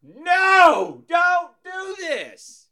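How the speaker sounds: high, loud desperate plea, slowing to a stretched cry; pleading shout. Retold or imitated. Fan in balcony begging the singer to stop, high pleading shout. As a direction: yell a warning she can't hear.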